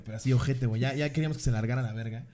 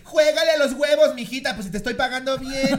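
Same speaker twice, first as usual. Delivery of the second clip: mock angry mom; 11 semitones higher, 6 dB louder than usual. Mom yelling at the dancer for bad service, hammed-up angry shout. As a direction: demand it like a furious customer. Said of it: "La señora encabronada".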